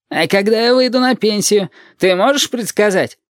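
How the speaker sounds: high-pitched mocking question; lightly acted sneering young cop; ironic. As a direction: tease the fortune-teller with mild sarcasm. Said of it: "иронизирует блондинчик".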